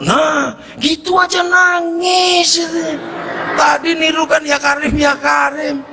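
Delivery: slow, high-pitched mocking cry; excited teasing shout.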